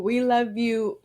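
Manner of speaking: normal talking voice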